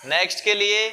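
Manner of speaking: ordinary delivery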